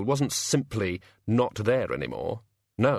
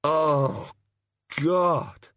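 "Oh, God" as a pained morning groan, pitched higher; imitating a groaning drinker; 6 semitones higher than usual. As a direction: mimic someone clutching their aching head. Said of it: "they clutch their head and say".

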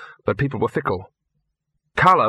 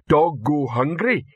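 slow, dim troll speaking; Detritus the troll; 4 semitones higher, slower than usual. Acted. Troll Detritus tells the trainers, slow and dull. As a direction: plod each word like a slow-witted troll. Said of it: "came Detritus's voice".

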